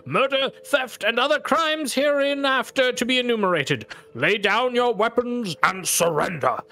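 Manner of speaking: high, loud commanding arrest; imperious voice. Acted. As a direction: proclaim the arrest with authority. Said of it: "like, imperious voice".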